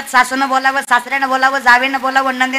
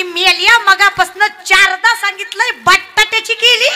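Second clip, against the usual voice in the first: angry retort; 6 semitones higher, 3 dB louder, slower than usual. Retold or imitated. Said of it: "रागा रागानं".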